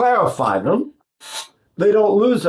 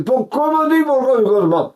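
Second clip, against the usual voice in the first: Neapolitan dialect; 8 semitones higher than usual. Retold or imitated. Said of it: "they say".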